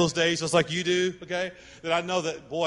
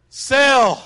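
a mock-thrilled cry, much louder and higher; magic word; 7 semitones higher, 10 dB louder than usual. Texter announces the sale to wives, hammed-up. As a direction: sell the word like a jackpot. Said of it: "the magic word".

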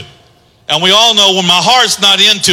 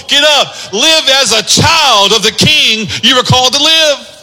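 God calling out; 6 semitones higher than usual. Acted. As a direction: cry out to the lost son to get up. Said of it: "He says".

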